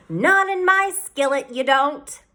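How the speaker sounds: high, slow scolding aunt; a bossy aunt guarding her skillet; stern aunt. A narrator's reading. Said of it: "she'd say".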